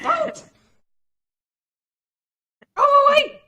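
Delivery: very high, loud shrill creature shriek; squeaky angry voice. Acted. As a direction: shriek like a tiny furious hermit. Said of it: "squeaky, angry voice".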